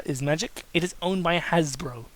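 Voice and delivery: ordinary talk, nothing marked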